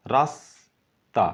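রাস্তা drawn out slowly, syllable by syllable; slow syllable split. Shown as a demonstration. Teacher slowly splitting রাস্তা for students. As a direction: stretch রাস্তা into its parts. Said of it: "লাস্টে শুধু তা আসছে".

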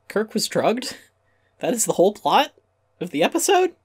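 high, laughing disbelief rising to a squeak; can you believe this, pitch climbing with a laugh; incredulous. Real, not acted. Essayist disbelieving the plot of Elan of Troyes, laughing high incredulity.

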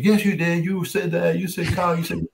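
high-pitched clamoring teammates, imitated; teammates' chorus. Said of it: "all my other teammates were like".

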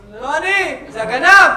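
loud, high, frantic protest; shouted denial. Acted. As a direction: yell your innocence up to the window.

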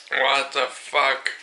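normal talking voice

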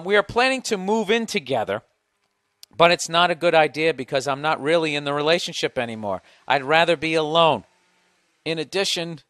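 loud, lifted reading voice; email read-out. Acted. Host reading on for his relationship expert, emphatic read-out.

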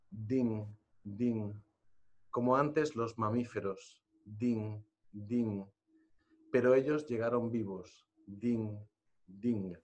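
slow, grave reading with a tolling ding refrain; solemn poem recital. Acted. Presenter reading a grave poem to the audience, solemn recitation. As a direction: let each ding fall like a mourning bell. Reads poem at book event.

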